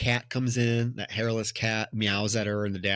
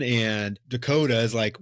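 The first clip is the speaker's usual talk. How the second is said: usual speaking voice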